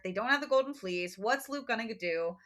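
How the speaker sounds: ordinary talk, nothing marked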